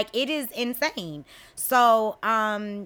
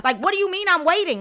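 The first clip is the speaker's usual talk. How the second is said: ordinary talk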